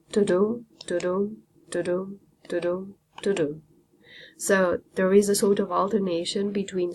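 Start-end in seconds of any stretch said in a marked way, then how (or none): 0.1-3.6 To-do chanted as unstressed-stressed, like a heartbeat; heartbeat rhythm. Shown as a demonstration. Teacher demonstrating the iambic beat to her class, rhythmic. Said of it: "it sounds like your heartbeat".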